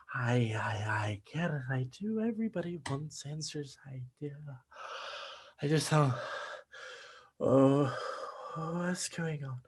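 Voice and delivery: faint, fading lines as he collapses; fading weakly. Acted. Son growing faint before collapsing, fading to a murmur. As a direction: let the voice drain away.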